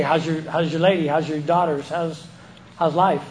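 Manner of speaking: ordinary talk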